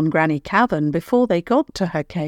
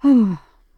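a big loud sigh; 5 semitones higher, 5 dB louder than usual. A narrator's reading. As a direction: sigh heavily before deciding. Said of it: "then sighed loudly".

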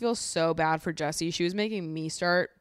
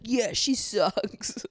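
mock Will, slow and smug; slow mocking repeat; 5 semitones higher, much slower than usual. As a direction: drag it out slowly, mocking Will. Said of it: "not be like".

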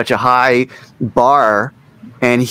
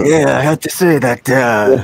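lightly put-on producer's voice, amused; Mutt Lange. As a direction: repeat the impression with a laugh behind it. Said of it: "that was my Mutt Lange voice".

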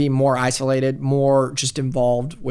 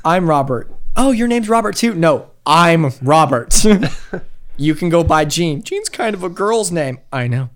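put-on switching between a bossy doll and a meek boy; playful back-and-forth of doll and child voices; hammed-up two-character bit; doll and boy skit; 4 semitones higher, 5 dB louder than usual.

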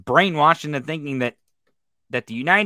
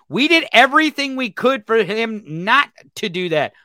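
rising, louder outraged disbelief; higher, louder furious rant; angry rant; 6 semitones higher, 4 dB louder than usual. Real, not acted. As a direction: let the outrage build as you go. Angry rant about Putin claim.

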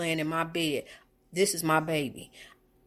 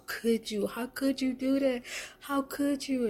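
retold yell; 9 semitones higher than usual. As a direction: pitch it up in distress. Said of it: "I yelled at my daughter".

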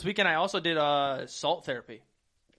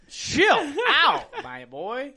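laughing protest; 9 semitones higher, 7 dB louder, much slower than usual. Real, not acted. Protesting friend's joke.

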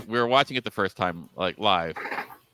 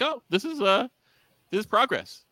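mock delight; 10 semitones higher than usual. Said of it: "I'm going like".

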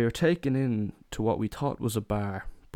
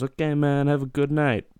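a bit higher and louder easygoing farewell; light driver voice; 6 semitones higher, 5 dB louder than usual. Retold or imitated. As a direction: wave them off breezily, as the cabbie. Driver says goodbye.

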